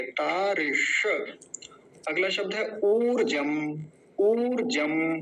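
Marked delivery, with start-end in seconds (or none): 0.0-1.1 तारिश very slowly, long ता; retroflex श in तारिश. Shown as a demonstration. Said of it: "मूर्धन्य शकार का विशेष ध्यान रखेंगे". 4.2-5.2 ऊर्जम slowly, first vowel stretched; long ऊ in ऊर्जम. Shown as a demonstration. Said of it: "बड़ा ऊ है".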